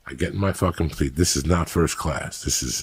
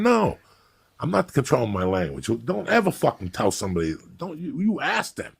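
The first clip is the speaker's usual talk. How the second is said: indignant retort; 8 semitones higher than usual. Retold or imitated. Acts out arguing with stewardess.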